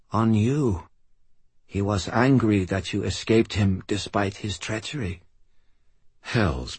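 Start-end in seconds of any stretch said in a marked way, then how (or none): 0.1-5.2 put-on weak old man. Dying old man speaks.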